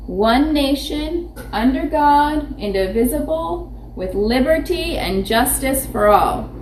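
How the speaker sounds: measured, sing-song pledge recital; pledge recitation. Acted.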